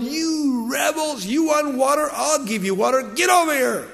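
high, angry retold yell at the crowd; hammed-up angry yell; acted yelling. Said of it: "he started yelling at the people".

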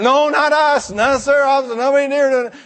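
put-on high, loud pleading kid voice; mock whiny denial. Caught teen pleading innocence to officers. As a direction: play the guilty kid denying it.

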